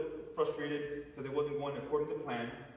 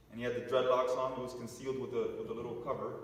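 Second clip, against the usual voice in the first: usual speaking voice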